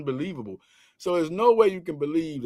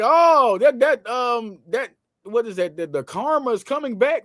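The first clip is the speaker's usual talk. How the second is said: higher, mocking voice of upset fans; 7 semitones higher, 3 dB louder than usual. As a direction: imitate the fans whining about karma. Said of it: "They're like".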